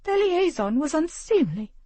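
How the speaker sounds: high, prim and disdainful; clipped superiority, lips pinched tight; haughty lady. Acted. The haughty mother justifies herself to her husband, prim and high.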